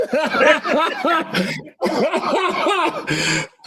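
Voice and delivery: cracking up and unable to stop; burst of laughter. Real, not acted. Opponent can't keep a straight face and bursts out laughing. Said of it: "trying to look serious, and just dying laughing".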